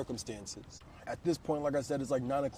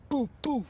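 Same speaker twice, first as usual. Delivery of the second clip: higher, punchy knock sounds; imitated door knocks; 11 semitones higher, 3 dB louder than usual. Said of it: "loud ass boop".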